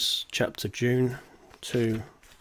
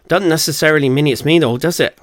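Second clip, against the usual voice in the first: loud, high, fast put-on accent; Paul's accent; 5 semitones higher, 9 dB louder, faster than usual. Paul teasing Tony on the phone in a put-on accent. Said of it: "Forgive the accent".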